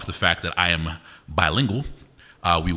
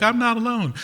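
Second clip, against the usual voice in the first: high, breathless mock confession; mock relief. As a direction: squeak it out like a relieved drooler. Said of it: "and you're like".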